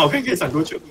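usual speaking voice

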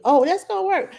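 high, sing-song fake reassurance; mock promise. Mocking the parent advice that always works, in a high fake-cheerful voice. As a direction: oversell it like a lie. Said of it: "lie to you and tell you".

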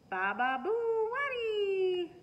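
put-on high, slow, loud baby babble; baby yell. A narrator's reading. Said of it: "Louie yelled".

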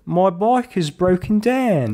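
high, slow hammed-up Cockney; Cockney accent. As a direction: do an exaggerated, drawn-out Cockney accent. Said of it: "That was Cockney".

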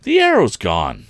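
loud, alarmed cry; cried out. A narrator's reading. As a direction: shout it in sudden shock at the empty dock. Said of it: "and then cried out".

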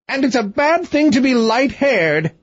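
high-pitched mock scream of a cross queen. A narrator's reading. Angry queen shrieks after her departing husband, high mock scream. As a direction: shriek it up high in a temper, half acted. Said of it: "screamed she".

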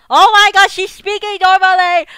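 high, very loud exclamation; stunned, high-pitched outburst; astonished shout. Real, not acted. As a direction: yell it in real amazement. Shocked at character speaking normally.

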